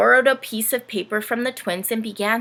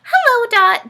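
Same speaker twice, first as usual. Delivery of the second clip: high, loud giggly greeting; 12 dB louder than usual. Acted. Ness greeting Dorothy, high and giggly. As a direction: greet her through a giggle. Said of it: "giggled Ness".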